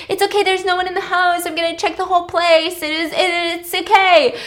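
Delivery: high, hurried acted reassurance; flustered reassurance. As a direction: imitate someone trying to calm a panic. Acting him reassuring the panicking woman.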